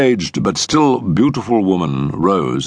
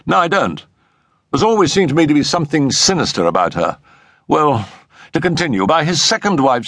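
markedly higher voice than the narration; a high-pitched friend telling the family history; Settle's explanatory voice; 9 semitones higher than usual. Acted.

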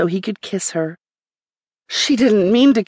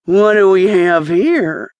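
slow voice muffled through a mask; 4 dB louder than usual. Acted. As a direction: speak as if through a cloth mask. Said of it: "he asked with a muffled voice".

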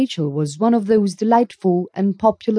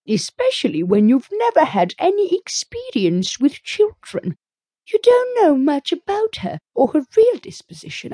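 high, meddling busybody woman; a nosy neighbour full of doubts; gossipy neighbour voice; 7 semitones higher than usual. A narrator's reading. Rachel voiced in story.

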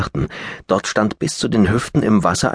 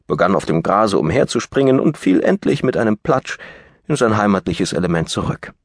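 plain reading voice

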